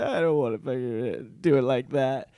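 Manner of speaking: mildly hammed-up tearful fighter; mock crying. Imitating fighter rejecting the belt, mock crying. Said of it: "he's crying".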